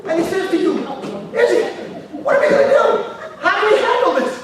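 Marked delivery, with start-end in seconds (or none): 1.3-4.3 high, loud, excited questions; worked-up pleading. Acted. Friend asking Izzy what to do, worked up. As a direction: beg frantically for a plan.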